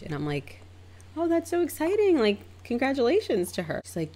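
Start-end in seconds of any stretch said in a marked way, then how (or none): 1.2-3.8 high-pitched congratulatory gush; high, polite enthusiasm; polite excitement. Retold or imitated. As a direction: react sweetly to someone's news, pitch way up. Polite reaction to engagement news.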